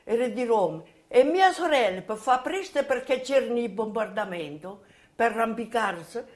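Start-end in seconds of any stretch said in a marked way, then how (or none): none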